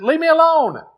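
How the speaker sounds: high, pleading teacup voice; a small cup begging to be left alone. Acted. Teacup begging the master, high and pleading. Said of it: "and I said".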